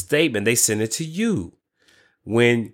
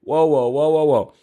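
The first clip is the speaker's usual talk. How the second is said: excited; 5 semitones higher, slower than usual. Retold or imitated. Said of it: "I was like".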